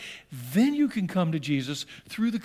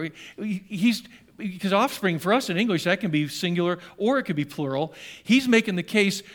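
normal talking voice

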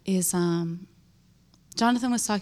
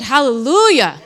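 joyful praise; 4 semitones higher, 11 dB louder than usual. Real, not acted. Praise shout in testimony.

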